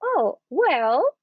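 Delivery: "Oh, well," lifted high and bright; bright, assured pause-filler; confident thinking well. Shown as a demonstration. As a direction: sound sure while you buy time. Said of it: "And I'm gonna say".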